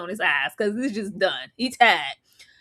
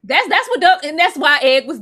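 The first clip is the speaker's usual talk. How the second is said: ordinary talk, nothing marked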